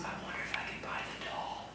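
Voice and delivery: hushed conspiratorial whisper. Real, not acted. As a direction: whisper it so nobody hears. Secret wish about the doll.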